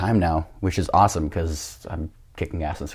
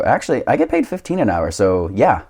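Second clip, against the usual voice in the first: higher, louder comeback to a threat; retold cocky retort; 3 semitones higher, 6 dB louder than usual. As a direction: act out standing your ground, pitched up. Retorting to a man threatening him.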